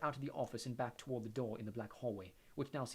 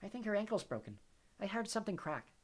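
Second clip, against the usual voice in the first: dreamy Luna; 9 semitones higher than usual. A narrator's reading. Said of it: "whispered Luna".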